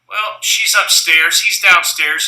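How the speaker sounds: ordinary delivery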